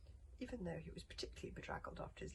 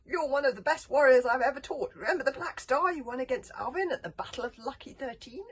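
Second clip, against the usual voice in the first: loud, high, hearty bellowing; calling out; 9 semitones higher, 17 dB louder than usual. A narrator's reading. Teacher urging his warrior to the right side, loud bellow. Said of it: "called Gobber".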